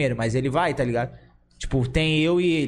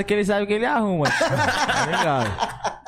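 laughing; 4 semitones higher than usual. Real, not acted. Teasing a teammate, laughing.

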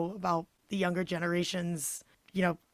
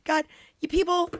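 normal talking voice

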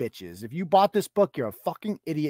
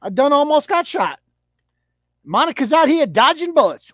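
higher and louder, hammed-up fear of bullets; mock frantic yelling; mock panic; 8 semitones higher, 6 dB louder than usual. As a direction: play it up like ducking gunfire. Mocking Monica dodging bullets.

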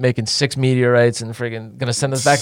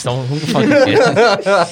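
laughing; 5 semitones higher, much slower than usual. Retold or imitated. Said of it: "I'm like".

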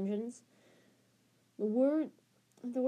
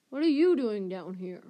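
louder, startled outburst; surprised; 6 dB louder than usual. A narrator's reading. Ron running into Percy in the dungeon, loudly surprised. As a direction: caught off guard and blurting it out. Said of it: "said Ron in surprise".

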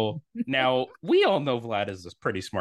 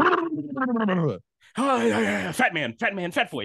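mocking fan taunt; 9 semitones higher, much slower than usual. Mocking Yankee fans' taunts.

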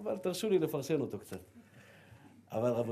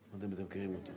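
ordinary talk, nothing marked

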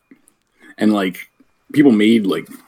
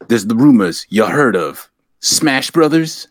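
ordinary talk, nothing marked

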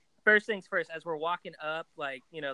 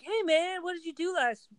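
dude bro, raised high and eager; bro guy voice; 10 semitones higher, faster than usual. Retold or imitated. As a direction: play the chummy gym bro, pitched up. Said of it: "one of those guys".

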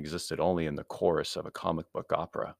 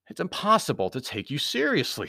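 lightly higher-pitched, amused hero; amused Anthony, mocking; 7 semitones higher than usual. A narrator's reading. Anthony mocking the intruder, amused. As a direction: tease the villain, pitched up.

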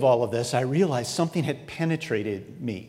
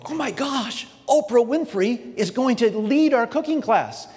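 much higher, giddy excitement; starstruck gush; 8 semitones higher than usual. Pretend. Starstruck at meeting Oprah.

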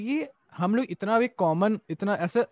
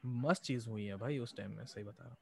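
low, very soft, moved voice; emotional; 7 semitones lower, 14 dB quieter than usual. Real, not acted. Podcaster recalling that time in his life, really emotional. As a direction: let the voice sink, quietly overcome. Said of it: "मैं इतना भावुक हो गया हूँ".

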